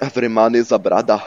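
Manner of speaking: loud mimicry of a fake Jamaican accent; mock Jamaican accent. Player mocking a game character, in a fake Jamaican accent. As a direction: parrot it loudly in a bad Jamaican accent. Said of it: "fake Jamaican accent".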